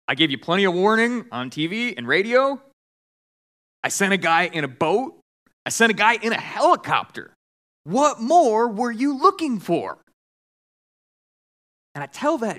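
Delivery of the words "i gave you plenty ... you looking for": acted exasperation, rising to the punchline; exasperated retort. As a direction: spell it out to someone who missed the obvious. God answers the complaint in exasperation.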